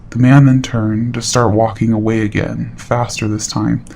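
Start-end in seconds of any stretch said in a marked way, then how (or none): none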